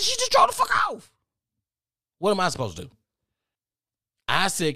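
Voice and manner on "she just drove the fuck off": very high, incredulous shout; retold outburst, voice shooting way up; shouting back. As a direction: yell it back in high-pitched disbelief. Arguing back on the phone.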